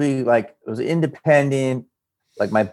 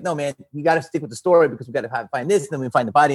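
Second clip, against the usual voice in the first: rushed; much faster than usual. Retold or imitated. Quoting a rushed TV crew.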